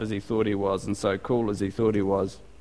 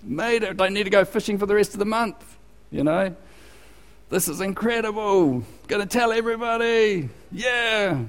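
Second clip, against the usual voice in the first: high, gleeful bragging fisherman voice; mock excited fisherman; 8 semitones higher than usual. Fisherman crowing to his mates about the catch, hammed-up excited. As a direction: ham up the delight over a huge catch. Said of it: "What do you expect him to go".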